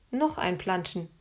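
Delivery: usual speaking voice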